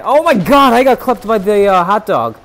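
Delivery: high, loud outburst of disbelief; loud dismay. Real, not acted. Player got clapped by hot dog, loud dismay. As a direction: cry out at getting beaten.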